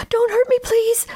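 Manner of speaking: put-on high frightened pleading boy; scared begging. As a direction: beg in a high scared squeak. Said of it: "the scared boy begs".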